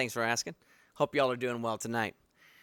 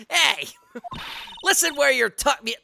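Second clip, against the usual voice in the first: a hint of a cranky old woman scolding, a little louder; grumpy old security lady; 11 semitones higher, 4 dB louder than usual. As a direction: nag the tourists like a crabby old guard.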